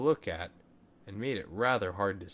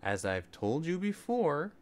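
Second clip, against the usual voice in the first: higher, sugary mother's voice; mother calmly refusing her son, sweetly; 7 semitones higher than usual. Acted. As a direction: sweeten it like a patient mum. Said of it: "his mother said sweetly".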